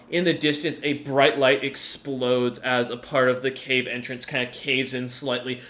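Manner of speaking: ordinary delivery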